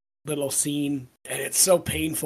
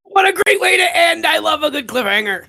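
much higher, loud delighted outburst; thrilled shout; 13 semitones higher, 9 dB louder than usual. Real, not acted. Podcast cohost delighting in the chapter's cliffhanger, shouting. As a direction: cheer the cliffhanger ending.

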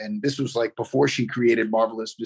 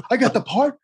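high-pitched incredulous question; stunned disbelief; 11 semitones higher, 4 dB louder than usual. Retold or imitated. Actor hearing the good news from the show's creator, stunned. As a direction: ask it high, rising at the end. Actor retells learning he got part.

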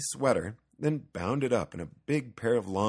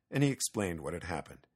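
ordinary delivery

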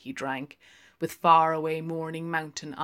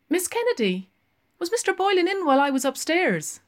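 put-on quick, much higher young barmaid; 13 semitones higher, 8 dB louder, faster than usual. Barmaid answering Lenehan, high and quick. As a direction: pert barmaid, rattling it off.

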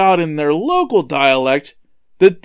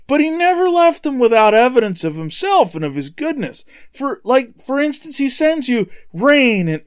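high-pitched acted appeal, easing in force; mild imitation of apostles calling out; shouting to crowd; 7 semitones higher than usual. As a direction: keep reasoning with the crowd in a lifted voice.

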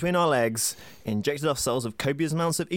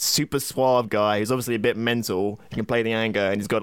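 ordinary talk, nothing marked

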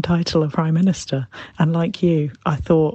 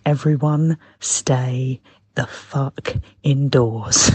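slow, word-by-word mock command; drawn-out, emphatic retold order; imitating dad's order; 2 semitones lower, much slower than usual. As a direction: lay down the law like a dad, very slowly.

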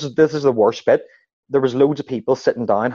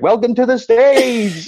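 mock announcer; 11 semitones higher, 4 dB louder, slower than usual. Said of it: "you're like".

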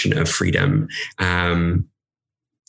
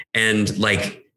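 ordinary talk

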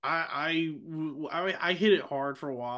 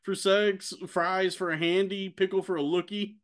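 pitched-up put-on voice of the sitcom hooker; mock Patty voice; 6 semitones higher than usual. Podcaster quoting a sitcom hooker's courtroom excuse, higher put-on voice. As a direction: play the hooker defending herself on the stand, voice pushed higher. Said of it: "She's like".

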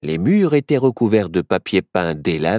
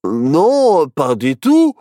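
mildly flustered denial; stammering; 4 semitones higher, 5 dB louder, much slower than usual. Acted. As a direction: deny it, caught out. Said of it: "bredouilla Barnabé". (Barnabé bredouille)

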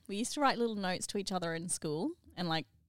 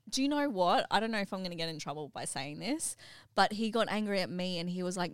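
ordinary delivery

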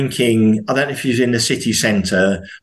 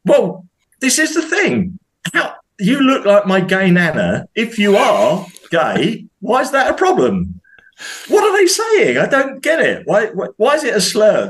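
incredulous; 8 semitones higher than usual. Real, not acted. Exasperated disbelief at the arrest.

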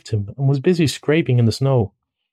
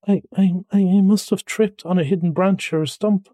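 mildly miserable, high-pitched complaint; high, hurt and sorry; 7 semitones higher than usual. Acted. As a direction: stammer it out, sorry for yourself. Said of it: "said the mole miserably".

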